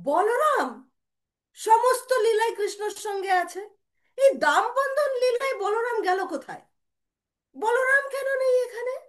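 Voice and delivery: loud, high-pitched challenge; insistent questioning. Retold or imitated. Devotee demanding where Balaram is, loud and insistent.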